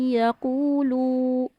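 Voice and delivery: slow یقولو with the لام stretched; wrong recitation. Shown as a demonstration. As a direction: drag out the لام. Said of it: "یہ آپ نے یہ کر دیا". (غلط: لام لمبا)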